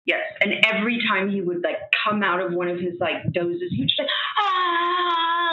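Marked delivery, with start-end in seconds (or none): 4.4-5.5 short sharp cry of pain, mimicking her brother; retold cry of a dying man in pain; imitated pain scream. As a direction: blurt out a brief mock scream of agony. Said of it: "just screaming in pain".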